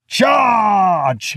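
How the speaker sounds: loud, high battle cry; yelled. A narrator's reading. Odysseus leads his men at the Cyclops, yelled. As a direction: yell the order to attack. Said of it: "yelled Odysseus".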